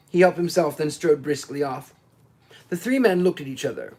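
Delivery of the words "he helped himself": usual speaking voice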